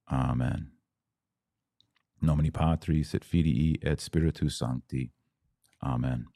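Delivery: hushed liturgical recitation; Latin prayer. Acted. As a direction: pray the Our Father reverently, in Latin.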